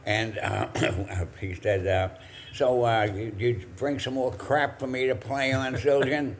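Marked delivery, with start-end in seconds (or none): 2.6-6.3 higher, louder scoffing jab; teasing jab at the cymbal rep. Retold or imitated. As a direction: needle the salesman like a gruff bandleader. Buddy mocking the cymbal salesman.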